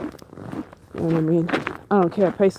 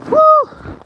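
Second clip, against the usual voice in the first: startled whoop; 7 dB louder than usual. Real, not acted. Relief after the scare.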